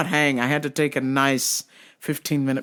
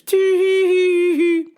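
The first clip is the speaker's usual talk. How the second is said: high mock tee-hee giggle, louder; a silly little giggle pitched way up; 13 semitones higher, 4 dB louder than usual. Worker mimicking the customer's odd giggle, high.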